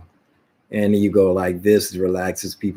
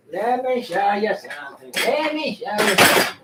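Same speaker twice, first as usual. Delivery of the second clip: silly high voice; 11 semitones higher, 3 dB quieter than usual. Pretend. Sudden high odd voice.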